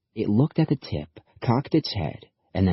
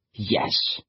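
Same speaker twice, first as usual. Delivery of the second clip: snarled; 3 dB quieter than usual. A narrator's reading. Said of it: "he snarled".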